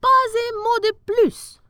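high, loud, slow royal exclamation; high, slow regal cry; sultan's cry. Acted. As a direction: cut him off grandly. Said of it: "s'écria le sultan".

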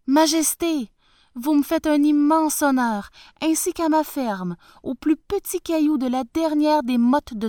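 high, loud humble peasant voice; bow and scrape to the tsar; humble farmer. Acted. Farmer kneeling before the tsar.

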